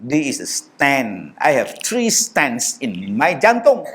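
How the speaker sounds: put-on slow, careful Malay-accented English; explaining in English. Explaining to the airport officer, slow accented English. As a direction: spell it out slowly to the officer. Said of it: "Saya kata".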